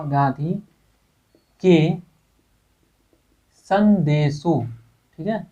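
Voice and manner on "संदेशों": संदेशों drawn out slowly, each syllable clear; teacher spelling संदेशों slowly for students; slow dictation. Shown as a demonstration. Teacher dictating to students, drawn-out संदेशों. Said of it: "बिल्कुल ध्यान से देखिएगा".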